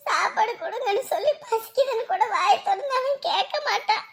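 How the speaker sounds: high, sobbing plea; tearful crying. Acted. Mother asking in-law to feed her son, crying. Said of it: "விடுங்க, விடுங்க, அழாதீங்க".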